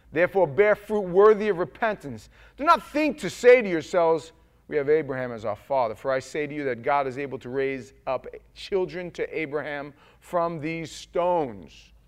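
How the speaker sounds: loud, high preaching of the verse; preaching scripture. Acted. Preacher reading Matthew to the congregation, full force. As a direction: thunder out the Gospel reading.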